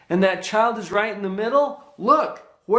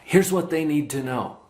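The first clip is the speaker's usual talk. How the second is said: usual speaking voice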